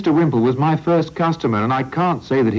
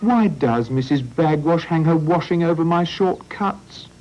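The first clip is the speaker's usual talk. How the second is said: moaned; 9 semitones higher than usual. Acted. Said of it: "moaned Sancho".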